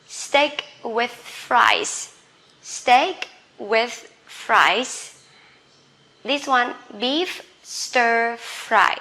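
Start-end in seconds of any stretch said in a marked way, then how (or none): 0.1-5.2 steak with fries drawn out very slowly; slow model reading. Shown as a demonstration. Teacher reading menu to class, very slow and clear. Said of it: "teacher modelling menu words". 7.0-9.0 slow model reading. Shown as a demonstration. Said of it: "teacher modelling menu words".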